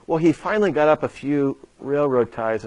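ordinary delivery

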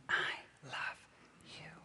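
tender whisper. Retold or imitated. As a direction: whisper the name tenderly, like a mother. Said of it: "you'll always hear the same thing".

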